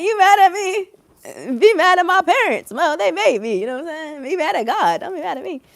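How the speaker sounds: high-pitched playful protest; mock indignant. Streamer answers jealous haters, mock indignant.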